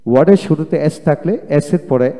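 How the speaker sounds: ordinary talk, nothing marked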